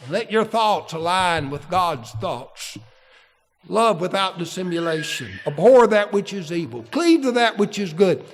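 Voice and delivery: loud, full-force pulpit preaching; fiery preaching. Acted. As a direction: thunder the verses at the congregation.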